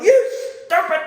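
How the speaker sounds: high, loud exasperated yell at the TV; yelling at the screen. Retold or imitated. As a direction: shriek the insult at the character. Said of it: "yelling at the screen going".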